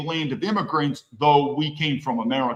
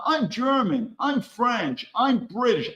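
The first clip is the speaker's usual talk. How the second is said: lightly mocking voice, pitch raised; mock claimant; 6 semitones higher, slower than usual. Mocking immigrants who claim to be German. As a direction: parrot their claim with a sneer.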